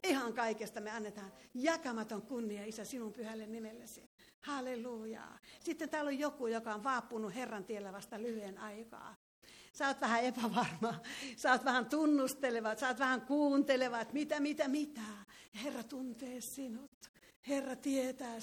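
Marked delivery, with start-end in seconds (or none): none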